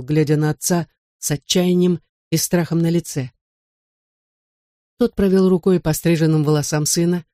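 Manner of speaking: ordinary delivery, nothing marked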